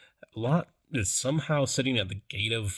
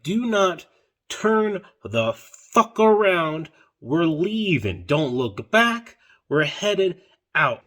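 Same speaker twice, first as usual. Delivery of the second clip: slow, louder, emphatic warning; stern command; 4 semitones higher, 4 dB louder, slower than usual. Retold or imitated. Angels warning Lot's family, slow stern command. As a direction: lay down the law word by word.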